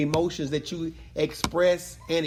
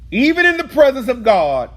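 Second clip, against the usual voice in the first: emphatic; 7 semitones higher, 8 dB louder than usual. Real, not acted. Teacher opening with emphatic point.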